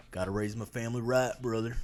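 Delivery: lightly voiced Southern guy; southern accent. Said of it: "I'm doing a southern accent".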